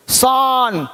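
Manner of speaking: "सान" with a long aa; wrong pronunciation. Shown as a demonstration. Teacher shows learners a misreading, wrong pronunciation. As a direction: stretch the mistaken "सान" with an open aa. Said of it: "गलत".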